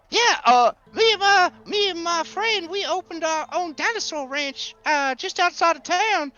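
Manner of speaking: high, eager, folksy storyteller; chatty, excitable Gus. Acted. Rancher telling strangers about a discovery, eager and folksy. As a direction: tell it excitedly, pitch lifted throughout. Game master plays Gus.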